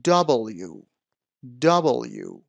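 "دبلیو" as dah-bol-you, middle rounded; correct pronunciation. Shown as a demonstration. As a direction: repeat دبلیو carefully as DAH-bol-yoo. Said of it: "نه دبلیو".

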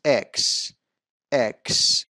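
"اکس" as eks, with a short e; correct pronunciation. Shown as a demonstration. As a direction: say اکس as EKS, clearly separated. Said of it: "اشتباه هست".

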